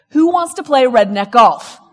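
loud rallying call to the room. Real, not acted. To the audience, a loud rallying call. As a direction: shout the question out to everyone.

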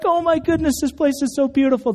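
amazed. Retold or imitated. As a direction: sound blown away by the new house. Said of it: "They're like".

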